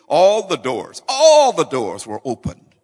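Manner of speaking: building to a loud shout; loud, exultant pulpit proclamation; triumphant preaching. Real, not acted. Preacher climaxes the earthquake miracle.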